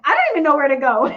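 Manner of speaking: loud, fast, panicky; flustered, rushed. Retold or imitated. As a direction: blurt it out in a fluster. Flustered new employee.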